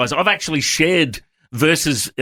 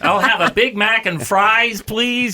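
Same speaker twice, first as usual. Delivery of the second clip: slow, higher-pitched bad American accent; terrible American accent; 7 semitones higher, slower than usual. Shown as a demonstration. Aussie pastor ordering at an American drive-through, badly faked American accent. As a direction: put on a drawn-out fake American accent. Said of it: "That is a terrible American accent".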